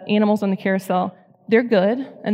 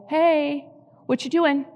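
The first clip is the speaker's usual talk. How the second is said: higher, light "Hey, what you doing?"; God's gentle question; 7 semitones higher, slower than usual. Retold or imitated. God interrupting the crying mom, lightly voiced higher. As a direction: voice God nudging her kindly, pitched up. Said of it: "God was like".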